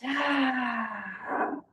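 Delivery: long loud sigh out; dramatic sigh. Real, not acted. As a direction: let a big sigh go. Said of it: "you can sigh as loud as you want".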